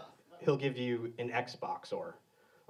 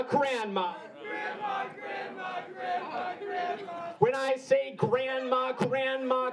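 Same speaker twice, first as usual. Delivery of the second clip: call and response; 11 semitones higher than usual. Acted. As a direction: lead the crowd in a chant. Call-and-response chant with crowd.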